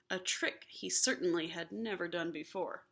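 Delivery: plain reading voice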